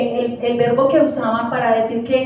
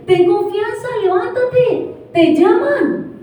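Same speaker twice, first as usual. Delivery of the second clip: higher, urgent calling out; crowd calling the blind man; 7 semitones higher, slower than usual. Retold or imitated. The crowd calling to the blind man, higher and urging. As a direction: call out to the blind man, coaxing. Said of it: "llamaron al ciego diciéndole".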